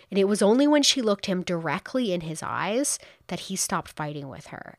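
ordinary delivery

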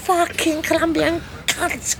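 high, slow mock rant; hammed-up fury. Prisoner ranting at officers, high slow mock fury. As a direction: spit it in exaggerated indignation.

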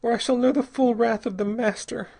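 high, hopeless woman's voice; resigned, high-voiced dread; dully. A narrator's reading. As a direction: state the threat in despair, pitched high. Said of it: "she said dully".

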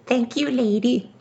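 very high, sweet little voice; high burger voice. Acted. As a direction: thank her in a tiny voice. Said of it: "The burger said".

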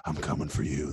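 menacing villain. Retold or imitated. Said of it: "he says".

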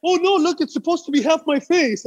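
high, laughing mock protest; mock defensive guy. Half-skull client explaining his tattoo to friends, high mock insistence. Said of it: "be like".

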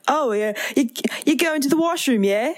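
put-on light British accent with rising yeah. To Danny before the washroom, light British accent. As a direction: tack a British yeah on the end. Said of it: "a British yeah onto the".

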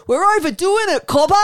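high, loud hammed-up Aussie twang; Aussie ocker accent, played up for laughs; mock Australian accent. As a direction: lay the Australian accent on thick as a joke. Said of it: "not having enough Australian accents".

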